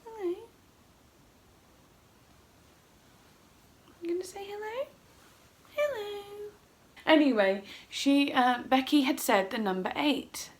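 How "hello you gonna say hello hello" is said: soft, high coaxing coo; pet talk. Real, not acted. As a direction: coax a pet gently in a high voice.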